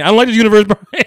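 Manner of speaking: high, loud cartoon-style quote; Stewie. Recalling a cartoon duo lost in the multiverse, high mock complaint. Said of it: "it gave me Stewie and".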